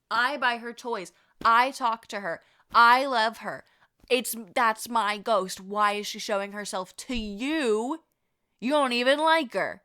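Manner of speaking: loud, indignant, emphatic complaint; acted jealousy. Storyteller voicing Deb envious of Tony's sighting, loud jealous protest. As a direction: punch each claim possessively. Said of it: "So jealous. Oh my God, she's so jealous".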